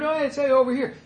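high, casual hail; mock cheery greeting. Preacher imagines a modern greeting to Jesus, mock cheery. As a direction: toss off a high-pitched hello. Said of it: "that's probably what they would".

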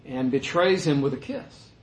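normal talking voice

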